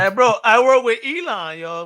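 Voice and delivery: high, loud bragging bro; playfully put-on big-shot boast; mock party bragger. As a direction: pitch it up like a bluffer at a party. Jokingly acts a party bluffer.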